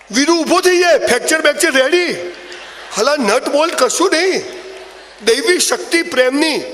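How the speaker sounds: mock-epic rising voice; filmy hero excitement. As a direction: hype the hero's comeback like a movie trailer. Comic hero rising, filmy climax.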